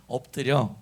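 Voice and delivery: loud, low-pitched lead for the congregation; repeat-after-me call. Shown as a demonstration. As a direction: call it out for everyone to echo. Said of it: "한번 따라합시다". (따라 하도록 크게 선창)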